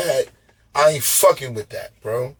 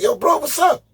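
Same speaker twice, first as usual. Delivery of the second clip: high mock bro voice. Mock greeting in a put-on voice.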